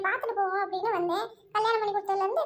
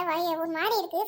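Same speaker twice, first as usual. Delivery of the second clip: normal talking voice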